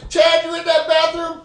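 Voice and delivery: laut und hoch durch die Tür rufen; calling out. Retold or imitated. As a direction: ruf ihn durch die geschlossene Tür. Yelling for friend through bathroom door.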